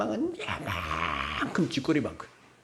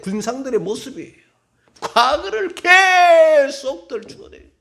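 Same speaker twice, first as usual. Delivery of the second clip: fiery preaching; 9 semitones higher, 9 dB louder than usual. Real, not acted. Pastor rebuking how people dig up old wounds, full-force shout. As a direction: thunder it at the congregation, laughing between blows.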